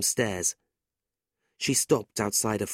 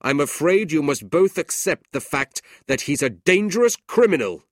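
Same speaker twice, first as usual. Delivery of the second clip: louder, cold accusing uncle; stern uncle; 6 semitones higher, 7 dB louder than usual. A narrator's reading. Ralph accuses his nephew to the family, cold and stern. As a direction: condemn him coolly.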